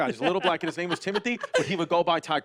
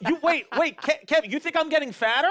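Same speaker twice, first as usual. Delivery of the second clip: high, louder, laughing protest; laughing indignation; 11 semitones higher, 5 dB louder than usual. Real, not acted. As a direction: squeal it out in offended disbelief. Offended by fat joke.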